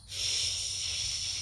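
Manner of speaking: soft unvoiced shushing breath; the wind in the leaves going shh; wind whisper. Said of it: "It whispers".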